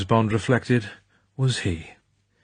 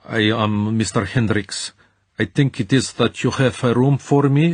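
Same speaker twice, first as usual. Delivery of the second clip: put-on thick Central European accent; 2 semitones higher, 3 dB louder than usual. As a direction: play a stiff Central European guest at the desk. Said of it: "said in a thick Central European accent".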